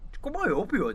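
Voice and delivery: high-pitched mock Boston bro; squeaky Boston bro voice; Boston accent. Mocking Boston bros.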